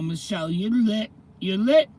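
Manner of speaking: mock teen hype, growing each time; imitating kids' slang, rising to a shout; hyped slang praise. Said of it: "this is how my kids talk".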